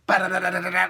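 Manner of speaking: quick comic punching noises; punching sound effect. Retold or imitated. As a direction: rattle off punches like a machine gun. Imitating punches while falling.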